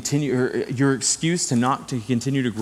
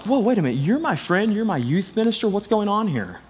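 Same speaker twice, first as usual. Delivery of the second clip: high-pitched, taken-aback objection; hurt disbelief, pitched high; retold shocked protest; 8 semitones higher than usual. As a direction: act out being stunned by a friend, voice way up. Acting out his shocked protest.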